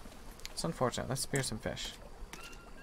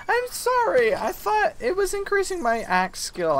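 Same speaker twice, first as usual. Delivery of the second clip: high, squeaky mock-guilty wail; 4 dB louder than usual. Gamer to the armadillo, a high mock-guilty squeak. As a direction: whine it out like a guilty little kid. Apologizing to the armadillos she kills.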